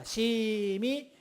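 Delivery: "심히" stretched long and soft; drawn-out 심히. Shown as a demonstration. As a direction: quietly hold out "심히" for a full turn. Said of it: "심히 할 때 한 바퀴 뺑 돌려서". ("심히"를 작게, 길게 늘여서)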